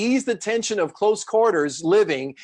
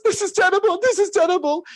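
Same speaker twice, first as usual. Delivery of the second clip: imitating George's whine; 13 semitones higher than usual. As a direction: play a sleepless man wailing in dismay. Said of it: "and he goes".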